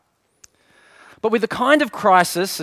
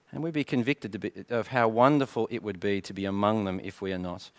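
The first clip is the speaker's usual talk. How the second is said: lower, softer prayer voice; 5 semitones lower, 4 dB quieter than usual. Real, not acted. Pastor praying over the congregation, soft and reverent. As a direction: pray it quietly and reverently.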